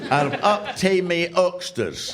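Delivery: slow, broad Ulster Scots; Ulster Scots reading. Retold or imitated. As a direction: relish the Ulster Scots words. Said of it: "Ulster Scots into English".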